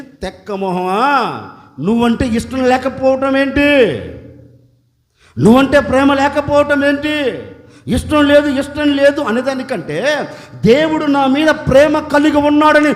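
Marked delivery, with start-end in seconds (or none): 0.0-9.2 louder scolding reply; God's angry retort. Acted. God scolds the complaining woman, loud and stern. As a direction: snap back at her in rebuke. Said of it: "దేవుడు కూడా వచ్చి కోపం".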